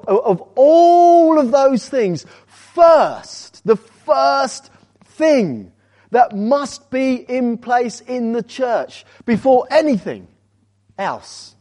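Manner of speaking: loud, high, drawn-out insistence; emphatic preaching. Real, not acted. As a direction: preach it hard, stretching the key words. Preacher drives home the first priority.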